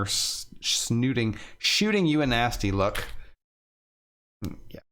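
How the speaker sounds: ordinary delivery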